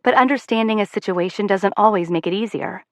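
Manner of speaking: usual speaking voice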